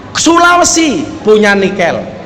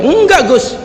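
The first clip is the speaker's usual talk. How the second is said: higher-pitched imitated contradiction; mock-insistent rebuttal, pitched up; stubborn protest; 7 semitones higher, slower than usual. Madurese driver argues back to the preacher, stubborn protest. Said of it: "Dia ngeyel, Pak Bupati".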